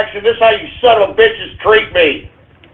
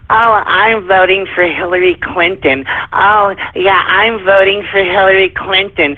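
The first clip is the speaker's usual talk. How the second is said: mocking feminine voice. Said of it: "the feminine vernacular coming out".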